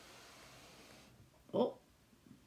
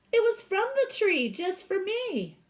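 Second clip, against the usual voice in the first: put-on higher, louder childlike insistence; insistent little girl; 12 semitones higher, 7 dB louder than usual. Sylvia insisting to Walt the tree gave it to her, higher insistent child voice. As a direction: insist like a small girl defending her gift.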